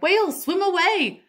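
urgent shout to swim away; cried out. A narrator's reading. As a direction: call the whales to flee. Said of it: "I cried".

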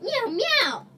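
loud, high mock meows; pretend cat meows. Gamer playing a cat, loud high meowing. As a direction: meow loudly like a silly cat. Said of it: "I'm a cat".

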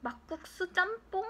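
muttered to himself, soft; under breath. Real, not acted. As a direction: read it half aloud. Reads sign to himself.

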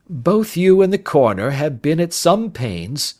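keen detective debating a colleague; amused Holmes. Acted. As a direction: make the point with lively assurance. Voices Holmes in dialogue.

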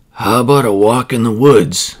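loud, hearty bear voice; Father Bear voice. A narrator's reading. Father bear invites mother bear out, loud bear voice. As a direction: speak up as a big father bear. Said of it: "Father Bear looked at Mother Bear".

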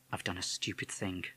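soft, private musing; quiet regretful thought; inner thought. A narrator's reading. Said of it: "Mister Holsworth thought".

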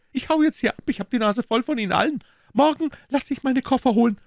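very high, fast, aggrieved maid; indignant maid. Acted. Maid complaining to her mistress, very high and fast. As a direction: rattle off the complaint, put upon.

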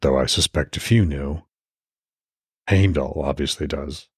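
even audiobook narration with lightly voiced characters; story narration. A narrator's reading. Podcast host reading his Norse novel aloud, steady narration. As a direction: read the chapter like a storyteller.

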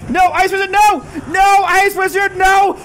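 loud, high pleading cry of no; desperate yelling. Real, not acted. Gamer yells at the Ice Wizard, pleading loud. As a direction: yell at the screen begging it to stop. Desperate plea as tower falls.

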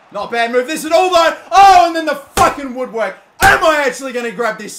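loud, high cry of disbelief; excited yell. Real, not acted. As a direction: shout as it hits the post. Shot hits the woodwork.

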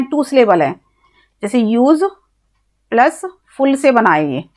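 ordinary talk

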